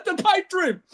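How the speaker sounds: retold in a high squeal; high-pitched exclamation. Host retelling his reply to his coach, high-pitched exclamation. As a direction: push it way up high, mock exclaiming.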